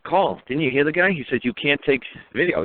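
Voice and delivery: ordinary talk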